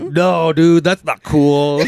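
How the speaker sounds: slow, high mock scolding; a hammed-up scold; mock disapproval. Commenter scolding him, slow and high. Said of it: "He's like".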